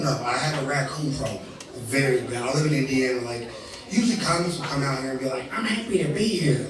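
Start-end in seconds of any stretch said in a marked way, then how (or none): 5.6-6.7 chirpy fake-happy comic voice, higher; mock a cheery touring comic; lightly hammed-up cheerful comic.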